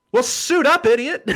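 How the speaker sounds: loud, high-pitched scolding shout; mock-impatient shouted command; imitated exasperated yell. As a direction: yell it like a friend giving an urgent order. Friend's urgent order, retold.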